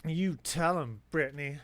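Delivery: hyped-up shout at the dog; loud cheer. Acted. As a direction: yell it like cheering from the stands. Repeat of the cheer, much louder.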